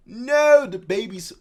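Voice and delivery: high, loud cry over the babies; anguished no. Acted. Mother crying out as her babies fall.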